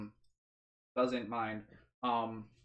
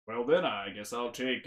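ordinary talk

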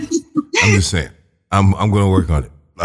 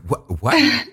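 surprised; 4 dB quieter than usual. Retold or imitated. Retells own shocked reaction.

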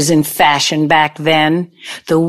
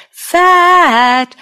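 FAT sung high, then stepping down; drawn-out, falling two-tone FAT; two-note singsong; 11 semitones higher, 3 dB louder than usual. Shown as a demonstration. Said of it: "first syllable up, second down".

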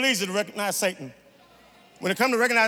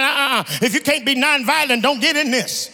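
loud, high-pitched sermon delivery; thundering preacher, driving each phrase home; full-force preaching; 7 semitones higher, 11 dB louder than usual. Acted. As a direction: preach it out to the whole congregation.

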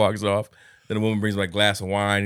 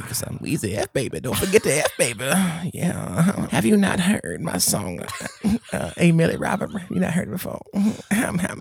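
higher, laughing rapper voice; 9 semitones higher than usual. Retold or imitated. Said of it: "you know, [name]".

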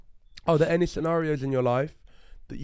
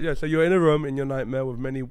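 normal talking voice

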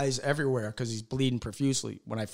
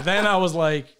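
ordinary talk, nothing marked

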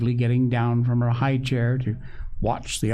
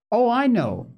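high, eager outburst; 12 semitones higher, 3 dB louder than usual. A narrator's reading. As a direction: burst out with the answer. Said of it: "he looked up eagerly".

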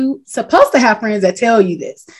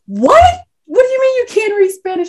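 "What?" shot up high, then a high baffled question; incredulous; 11 semitones higher than usual. Retold or imitated. Friend questioning her at Whataburger, incredulous. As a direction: sound truly baffled. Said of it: "So he looked and was like".

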